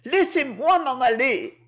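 high-pitched pleading cry; distressed girl calling to be let go. A narrator's reading. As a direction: cry out in protest, pitched well up. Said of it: "criait Proserpine".

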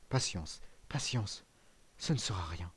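put-on soft, fading old man's murmur; barely holding on, voice sinking low and soft; faint and weak. Old father, fainting, reassures his son weakly.

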